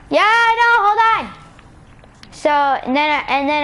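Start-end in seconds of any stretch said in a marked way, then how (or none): 0.1-1.4 high, sustained call back; impatient calling out. Real, not acted. Storyteller answering someone who is calling away, impatient.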